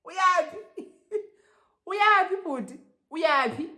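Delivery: high-pitched, loud mock challenge; hammed-up incredulous query; incredulous questioning. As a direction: demand it in squeaky disbelief.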